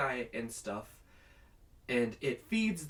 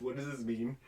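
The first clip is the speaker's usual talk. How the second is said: ordinary talk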